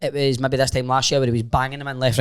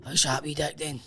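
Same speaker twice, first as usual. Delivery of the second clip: muttered jibe; 10 dB quieter than usual. Retold or imitated.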